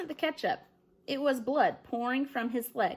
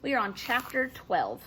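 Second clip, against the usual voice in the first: ordinary delivery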